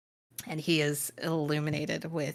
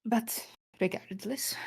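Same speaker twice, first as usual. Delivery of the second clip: normal talking voice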